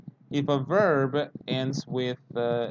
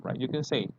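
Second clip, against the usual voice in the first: normal talking voice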